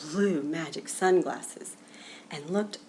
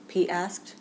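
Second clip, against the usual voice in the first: ordinary delivery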